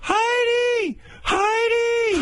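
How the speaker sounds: screeching falsetto shout of Heidi; High Pitch Eric yelling. Retold or imitated. Heckler shrieking at judge Heidi from the audience. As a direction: bellow the name in a squeal. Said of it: "He'd be there".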